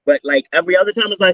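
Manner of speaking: ordinary delivery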